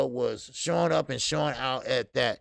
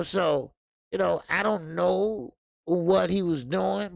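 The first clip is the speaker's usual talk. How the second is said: normal talking voice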